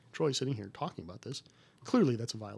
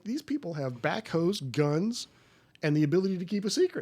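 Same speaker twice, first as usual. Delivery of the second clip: raised, louder retold rant; mock heated protest; 7 semitones higher, 6 dB louder than usual. Retelling what he told the bosses, louder and heated. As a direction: hammer the point home to the bosses.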